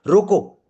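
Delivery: loud, high रोको with a falling end; forceful रोको. Shown as a demonstration. Trainer isolating the first word, forceful high रोको. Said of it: "रोको को forcefully किया".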